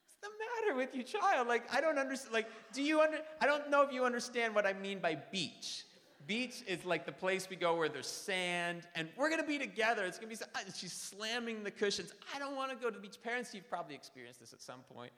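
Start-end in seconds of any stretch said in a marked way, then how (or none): 0.0-5.9 exasperated dad. Retold or imitated. 12.4-13.2 put-on whiny toddler. Toddler refusing again.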